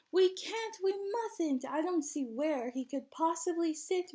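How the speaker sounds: put-on a boy's high, anxious protest; Musa in despair. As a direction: plead that there's no room. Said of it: "Musa cried in despair".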